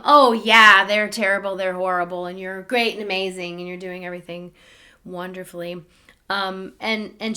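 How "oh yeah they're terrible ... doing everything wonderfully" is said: mock validation. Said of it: "somebody to say".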